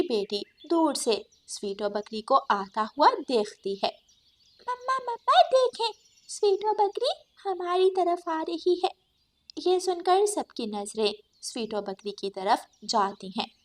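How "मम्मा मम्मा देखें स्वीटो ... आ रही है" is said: put-on high, excited child voice calling her mother; excited little girl. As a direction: point and call out to mama like a small child. Child bird voice. (ऊँची उत्साहित बच्ची की आवाज़)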